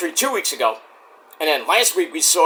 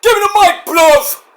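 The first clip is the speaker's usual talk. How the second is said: high, loud mock wrestler demand; hammed-up shouted promo voice; Will Ospreay; 13 semitones higher, 7 dB louder than usual. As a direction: imitate a British wrestler yelling. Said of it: "and then Otis, Ospreay's like".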